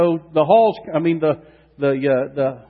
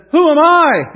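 higher and louder, disbelieving; incredulous cry; 11 semitones higher, 10 dB louder than usual. Acted. Gideon answering God's call, loud incredulous cry. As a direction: throw the question up in shock.

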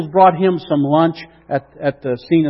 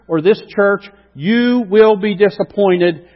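emphatic preaching; 5 semitones higher, 6 dB louder than usual. Acted. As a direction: hammer each do not.

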